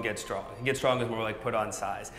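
usual speaking voice

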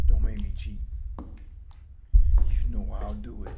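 slow whispered threat. Real, not acted. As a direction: whisper it, half joking. Threatening game jokingly.

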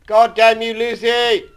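furious shouts at the monster; angry yelling. Real, not acted. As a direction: yell at her in rage. Chased by monster Lucy.

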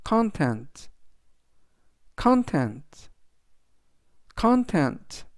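"content" stressed on CON, CON-tent; teacher models "content" for learners; model pronunciation. Shown as a demonstration. Teacher says "content" for students, clear and measured. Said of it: "라고 발음하시면 되겠습니다".

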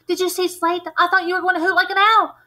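high, loud and fast, frightened; panicked. A narrator's reading. Scared kid demanding answers from friend, high loud panicked rush. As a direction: blurt it out in a panic. Panicked kids after scream.

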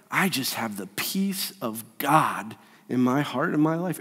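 soft, low, peaceful voice; serene saint. Acted. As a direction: speak from deep calm, hushed. Serene saint voiced amid suffering.

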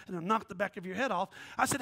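usual speaking voice

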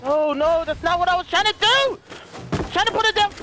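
high-pitched, exasperated protest; frustrated wail. Real, not acted. Gamer botching what he was trying to do, frustrated. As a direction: wail over your own mistake. Misplaced trap in game.

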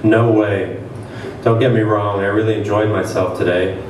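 ordinary delivery, nothing marked

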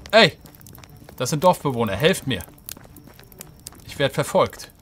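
loud, urgent shout for help; calling for help. Pretend. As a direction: yell to the villagers to save you. Ruft Dorfbewohner um Hilfe. (laut um Hilfe rufend)

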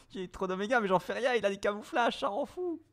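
high, frantic exasperation; exasperated. Real, not acted. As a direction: voice jumps high in frustration. Losing to camouflages in game.

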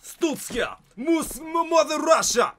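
high, loud fake Russian shouting; mock Russian accent. Gamer faking Russian for a challenge, mock Russian accent. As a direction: bellow in a put-on Russian accent. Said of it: "in a Russian accent".

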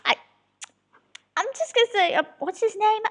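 normal talking voice

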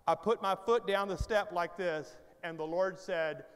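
ordinary delivery

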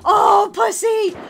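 loud exasperated yelling; frustrated yelling. Real, not acted. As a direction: yell at yourself, laughing. Failing in game, yelling.

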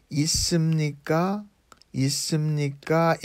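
있습니까 rising on the last syllable; rising intonation. Shown as a demonstration. Teacher showing learners a formal question ending, rising intonation on 있습니까. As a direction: say 있습니까 quickly, lifting the final 까 as a question. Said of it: "In a rising intonation".

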